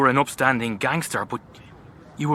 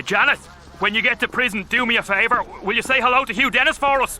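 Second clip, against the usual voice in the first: high, fast teasing taunt; mocking jab; 10 semitones higher, faster than usual. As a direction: tease her on the way out.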